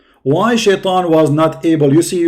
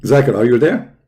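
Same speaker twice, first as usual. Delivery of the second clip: ordinary delivery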